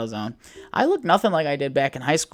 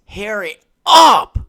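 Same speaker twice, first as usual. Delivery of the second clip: hyping himself up; 6 semitones higher, 12 dB louder, much slower than usual. Real, not acted. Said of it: "I'm just hyping myself up".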